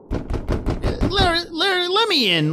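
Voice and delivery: high, urgent pleading call; desperate knock-and-call at the door; calling through the door. Acted. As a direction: beg to be let in, voice straining high.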